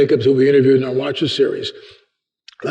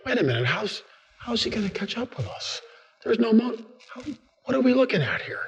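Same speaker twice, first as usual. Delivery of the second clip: much softer, confused muttering; puzzled hush; 5 semitones higher, 11 dB quieter than usual. Acted. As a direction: wonder aloud under your breath.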